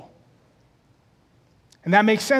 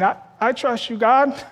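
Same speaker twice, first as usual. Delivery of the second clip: hesitant believer; 7 semitones higher than usual. Retold or imitated.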